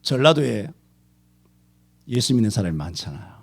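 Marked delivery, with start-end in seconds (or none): none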